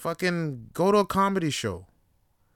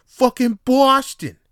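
slow, drawn-out, even louder Boston yell; Boston accent; 11 semitones higher, 10 dB louder, slower than usual. Retold or imitated. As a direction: stretch it like a proud Boston bro. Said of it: "proper Boston accent".

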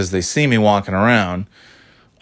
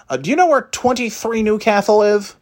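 much higher hesitant asking voice; imitating the driver; 12 semitones higher than usual. The delivery woman asks the narrator for an address. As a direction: ask like the lost driver. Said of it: "She sounded more like this".

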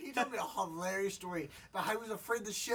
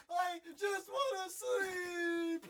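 whiny wailing, much higher and very slow; mock crying; 11 semitones higher, much slower than usual. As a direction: wail it like a sleepy, crying kid. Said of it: "he was crying".

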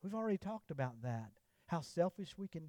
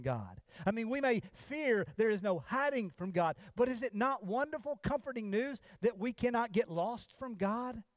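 louder, building sermon delivery; preaching; 4 semitones higher, 4 dB louder than usual. Acted. Pastor tells believers of Jonah, in rising preaching voice. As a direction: drive the Jonah story home from the pulpit. Preaching, raised over the storm.

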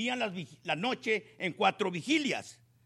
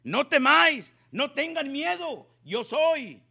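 louder, firm no temáis; reassuring call; 5 semitones higher, 5 dB louder than usual. A narrator's reading. Jesus calls to his frightened disciples, louder and firm. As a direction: call over the storm to calm them. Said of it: "dijo Jesús".

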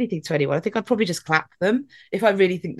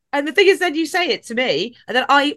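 normal talking voice

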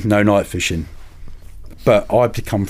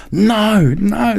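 much higher, louder desperate no; a hammed-up panicked plea; panicked protest; 13 semitones higher, 4 dB louder than usual. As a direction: beg them not to take the gear. Mates panicking about their gear.

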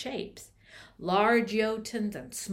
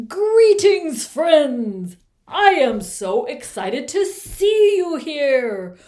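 loud, high booming giant greeting; booming frost giant; 6 semitones higher, 14 dB louder, slower than usual. Acted. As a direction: boom out a jolly giant welcome.

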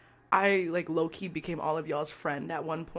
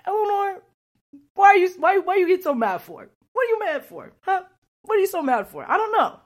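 much higher and louder, teasing scold; mock self-scolding; 13 semitones higher, 8 dB louder than usual. Scolding herself for getting mad in the interview, high and loud. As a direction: squeal at yourself like a nagging friend.

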